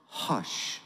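soft breathy hush spoken to the storm; calm the storm with a whisper. Acted. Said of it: "he said".

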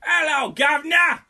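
loud, high mock British accent; Churchill impression. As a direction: holler it like a hammed-up Cockney governor. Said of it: "Churchill is like, ... that was a great impression".

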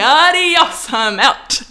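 high, loud, gleeful sign-off; playful triumphant goodbye; cheeky exit. Retold or imitated. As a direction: strut out with the food. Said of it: "I'm like".

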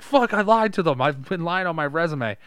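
high, loud panicked confession; mock panic. Voicing Beast admitting he lied, high panicked voice. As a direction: blurt out the lie.

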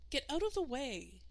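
put-on high bossy older sister; bossy girl. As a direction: snap it like a bossy twelve-year-old. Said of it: "ordered his sister Eleanor".

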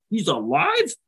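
can't believe what he's hearing; stunned disbelief. Retold or imitated. Paddles learns from the skipper the pilot lived, stunned.

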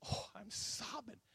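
high, hushed, drawn-out mock whimper; mock sobbing. Preacher re-enacting his sudden sobbing in the dream, softly whimpered. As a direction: squeeze it out softly like an imitated sob. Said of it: "and I start sobbing".

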